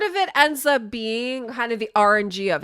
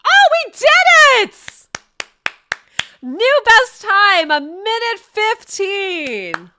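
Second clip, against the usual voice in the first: thrilled shout, much higher and louder, slowed right down; real excited cheer; 10 semitones higher, 10 dB louder, much slower than usual. Real, not acted. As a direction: celebrate the record at the top of your voice. New best time in the game.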